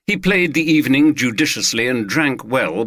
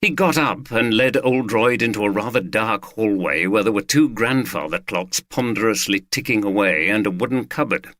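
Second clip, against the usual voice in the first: plain reading voice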